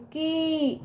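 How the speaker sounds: कि drawn out into a long kee; long, stretched कि. Shown as a demonstration. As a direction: stretch the vowel of कि. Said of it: "खींच के करते हैं". (कि को खींचकर)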